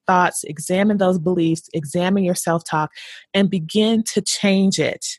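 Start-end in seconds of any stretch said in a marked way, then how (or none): none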